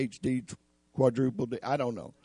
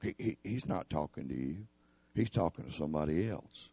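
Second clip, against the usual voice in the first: much softer, lower dismissive devil voice; devil's murmur; 6 semitones lower, 8 dB quieter than usual. Acted. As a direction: murmur it like the devil in your ear. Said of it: "he says".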